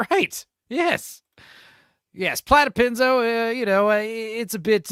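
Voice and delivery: very high silly voice; high character voice. Pretend. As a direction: answer in a squeaky persona. Playing Platypenzo in high voice.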